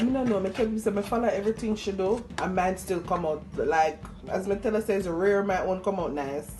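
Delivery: normal talking voice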